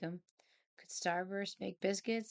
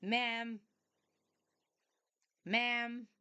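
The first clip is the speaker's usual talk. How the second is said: higher, insistent calls of ma'am; calling cat; 4 semitones higher than usual. Retold or imitated. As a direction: call out to get her attention. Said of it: "she's like".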